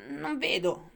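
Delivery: plain reading voice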